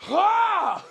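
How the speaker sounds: high, loud "ah" reenacting the stop; sudden loud high cry, acted out; imitated strained yell. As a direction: mimic a burst of frustration mid-set. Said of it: "and I'd just be like".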